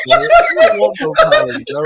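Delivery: high, loud, laughing protest; loud and high through laughter; laughing plea. Real, not acted. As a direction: laugh while begging out of the argument. Laughingly begs out of the argument.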